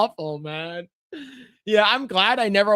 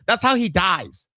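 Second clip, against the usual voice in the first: ordinary talk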